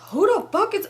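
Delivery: high-pitched indignant imitation; mock irate question. Boyfriend questioning whose house it is, mimicked irritation.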